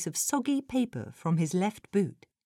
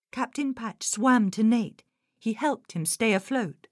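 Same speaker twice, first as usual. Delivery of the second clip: usual speaking voice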